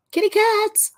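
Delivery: very high squeaky call to the cat; cat-talk voice. Real, not acted. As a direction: coo it up high like talking to a kitten. Talking to cat in baby voice.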